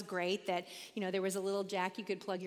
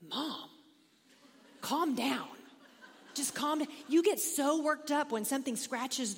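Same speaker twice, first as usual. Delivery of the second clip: imitating the kids, slightly higher; a child telling mom to calm down; 5 semitones higher, 3 dB louder than usual. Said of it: "finally they were like".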